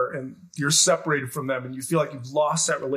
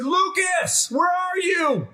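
frantic shout for a lost son; panicked dad calling through the empty lobby; 11 semitones higher, much slower than usual. Retold or imitated. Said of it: "and I said".

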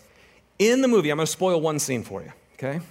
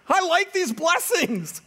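complacent believer; 10 semitones higher than usual. Retold or imitated. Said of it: "it's like".

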